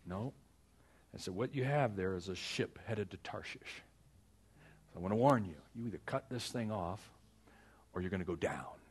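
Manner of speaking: ordinary talk, nothing marked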